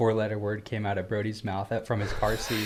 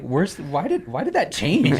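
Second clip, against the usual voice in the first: higher, rising questioning; mock bewilderment; 5 semitones higher, 3 dB louder, slower than usual. Father wondering why the kids changed, mock bewilderment. Dad acting out his bewilderment.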